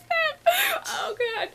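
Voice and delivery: high, squeaky "oh god"; high-pitched fan whimper; overwhelmed fangirling. Real, not acted. Overwhelmed reliving a fan moment.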